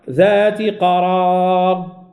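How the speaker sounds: slow chanted recitation, the vowel of قرار leaned toward e; Quranic recitation with imala. Shown as a demonstration. Teacher chants the verse to show imala. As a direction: chant ذات قرار slowly, the final alif of قرار bent toward e. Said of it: "فنقول".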